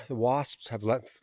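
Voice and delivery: normal talking voice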